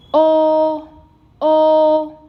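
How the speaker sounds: "o" held long, high and level; flat first tone. Shown as a demonstration. Teacher demonstrating the first tone, sustained flat. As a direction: sustain "o" loudly on one high note. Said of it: "first tone, sustain".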